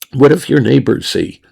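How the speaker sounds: ordinary talk, nothing marked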